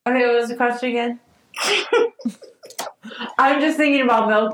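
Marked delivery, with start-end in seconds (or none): 1.5-2.3 high burst of laughter. Real, not acted. Laughing at the milk tangent.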